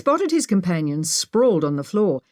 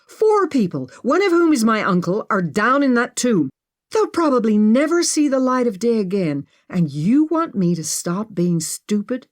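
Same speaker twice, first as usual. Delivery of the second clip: outraged; 5 semitones higher, 4 dB louder than usual. Acted. Maggie's outraged retort.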